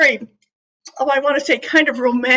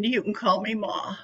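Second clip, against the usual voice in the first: gentle, soft; 4 semitones lower, 9 dB quieter than usual. Retold or imitated. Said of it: "he said".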